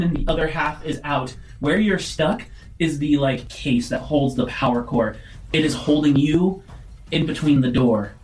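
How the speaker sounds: ordinary talk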